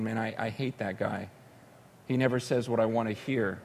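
low, soft retold grumble; subdued grumble. Preacher recalling the king's complaint about Micaiah, subdued grumble.

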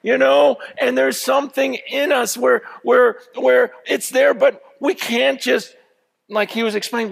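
high, loud, driving sermon voice; full-force preaching. Acted. Pastor stirring his congregation about leaving the cage, full force. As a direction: preach it hard, rising to the crowd.

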